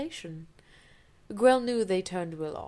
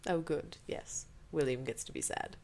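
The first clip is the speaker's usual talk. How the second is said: soft, dry mockery; sarcastic mutter; 4 semitones lower, 5 dB quieter than usual. Real, not acted. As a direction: roll your eyes at him. Reader mocks the character.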